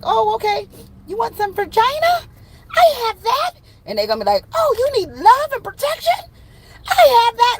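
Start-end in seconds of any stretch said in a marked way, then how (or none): 0.0-3.5 very high, playful put-on voice; mock eager offer. One partner offering what the other needs, mock eager. 4.5-7.6 very high, loud put-on voice; mock eager offer. Other partner offering love and protection, mock eager.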